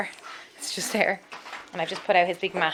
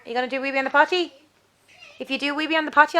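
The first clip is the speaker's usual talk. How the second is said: quick sing-song coaxing; 6 semitones higher, 4 dB louder, much faster than usual. Real, not acted. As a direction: hurry him along cheerfully. Repeated coaxing of toddler.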